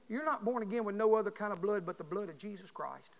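ordinary talk, nothing marked

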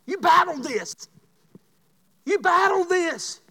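high, loud preacher's cry; preaching at full force. Acted. Pastor confessing to the congregation, full-force preaching. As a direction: hammer it home to the church.

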